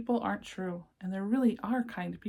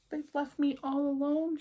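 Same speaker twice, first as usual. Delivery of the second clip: crying; 6 semitones higher than usual. Acted. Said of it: "she cried".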